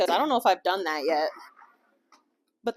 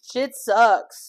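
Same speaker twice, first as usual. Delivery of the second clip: laughing; 6 semitones higher, 9 dB louder than usual. Real, not acted. Laughing before blunt remark.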